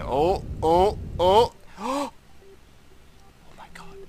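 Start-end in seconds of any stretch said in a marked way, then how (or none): 1.8-4.0 very high breathy oh my god; awed gasp. Real, not acted. Streamer gasping as he lands, very high. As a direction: gasp it in disbelief.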